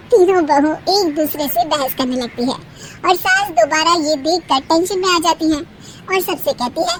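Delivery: ordinary delivery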